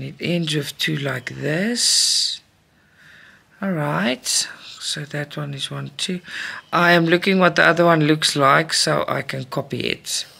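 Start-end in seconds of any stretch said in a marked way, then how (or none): 4.8-6.2 breathy counting under breath; whispered counting. Real, not acted. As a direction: count quietly to yourself.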